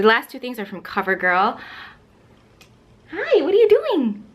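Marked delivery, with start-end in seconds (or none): 3.1-4.2 pet talk. Real, not acted. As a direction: coo at the dog in a much higher voice. Greeting her dog.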